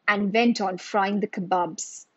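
usual speaking voice

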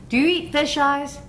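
ordinary talk

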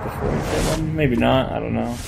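ordinary delivery

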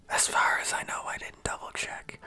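hushed unvoiced aside; whispering. Real, not acted. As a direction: whisper it like a secret confession. Quiet aside to viewers.

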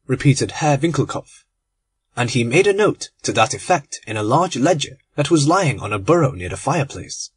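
ordinary talk, nothing marked

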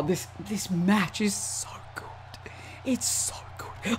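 slow, voiceless whisper; awed whisper. Real, not acted. Gamer marvelling at how good the match is, awed whisper. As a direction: drop to a hushed, overwhelmed whisper.

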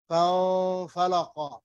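فانفلق with the noon hidden into the f, nasalised; فانفلق with ikhfa, no clear n sound. Shown as a demonstration. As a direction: say فانفلق gliding the n into the f with a nasal hum. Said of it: "I hide the noon in فاء".

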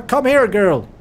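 loud coaxing call; calling the dog. Real, not acted. Player coaxing his dog back, loud call. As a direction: call her to come.

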